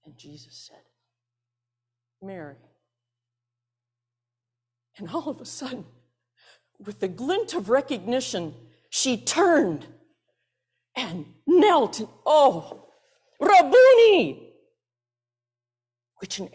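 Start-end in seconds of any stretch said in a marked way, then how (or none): 2.2-2.6 tender. Acted. Jesus names her softly. 12.2-14.6 a high, loud, slow cry of recognition; joyful cry. Acted. Mary recognizes Jesus, kneels.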